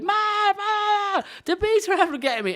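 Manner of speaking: high, frantic cry for mom; a child screaming for help; panicked child. Retold or imitated. Girl runs in from the bees, panicked high calls.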